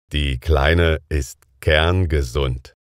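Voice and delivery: ordinary delivery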